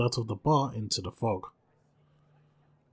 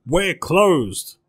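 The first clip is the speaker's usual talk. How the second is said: loud, higher shout at the door; 6 semitones higher, 10 dB louder than usual. Acted. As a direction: yell it through the room, nerve snapped. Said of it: "she shouted".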